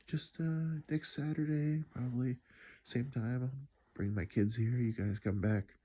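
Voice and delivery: put-on a dad calling kids back next week; lightly voiced cheerful father; voicing dad.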